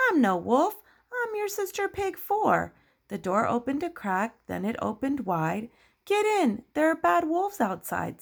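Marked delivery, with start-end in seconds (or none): 0.1-2.7 high-pitched little pig sister voice. Acted. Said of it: "And Sister Pig says". 6.0-8.2 high, urgent pig voice; Pig Three. Acted. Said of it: "says Pig Three".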